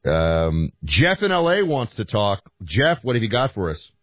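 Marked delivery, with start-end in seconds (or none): none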